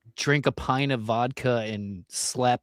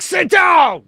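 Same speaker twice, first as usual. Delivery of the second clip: much higher, loud barking shout; hammed-up driver shouting orders; mock bus driver yell; 10 dB louder than usual. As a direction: yell at rowdy passengers to sit. Bus driver yelling at passengers.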